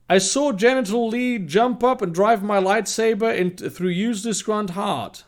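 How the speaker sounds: high-pitched, animated read-aloud; story narration. Acted.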